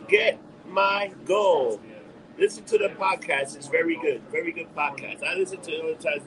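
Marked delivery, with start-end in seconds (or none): none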